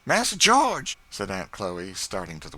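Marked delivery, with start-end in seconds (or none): 0.1-0.9 high, loud joyful cry; calling out as she rushes to the window; excited call. Acted. Chloe cries out as young master George arrives, high excited call.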